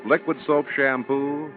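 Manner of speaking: ordinary talk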